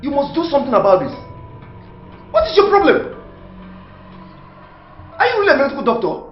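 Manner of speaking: loud, high, fast scolding; furious demand. Acted. Man demands action from doctor, loud and furious. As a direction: press the doctor angrily.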